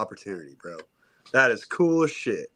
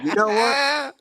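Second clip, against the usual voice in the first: mock fired-up retort; 14 semitones higher, 3 dB louder, slower than usual. Retold confronting the guys.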